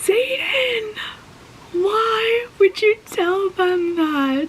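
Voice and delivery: high, slow, crying out; anguished cry. Real, not acted. Reader crying out at Xaden, anguished. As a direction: plead with him through tears. Distraught at the character.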